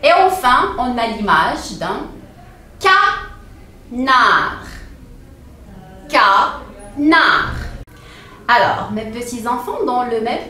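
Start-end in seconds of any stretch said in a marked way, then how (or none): 2.8-4.7 CANARD in two syllables with a long pause between. Shown as a demonstration. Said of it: "compter des". 6.1-7.6 CANARD split into two separate beats; syllable by syllable. Shown as a demonstration. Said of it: "compter des".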